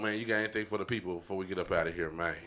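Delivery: normal talking voice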